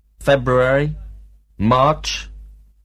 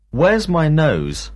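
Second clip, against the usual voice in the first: slow, clear classroom question; slow teacher question; 5 semitones higher, slower than usual. Shown as a demonstration. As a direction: ask it slowly for the class. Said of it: "teacher drilling questions".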